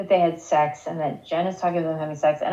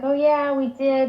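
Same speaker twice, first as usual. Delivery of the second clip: higher, lightly mocking dreamy quote; cheesy mock gushing; 6 semitones higher, 3 dB louder than usual. Jen answering Grams about the night, mildly mock-gushing. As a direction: gush it a little, as cheesy imitation. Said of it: "this part was so fucking cheesy".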